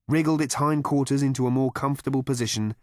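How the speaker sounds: plain reading voice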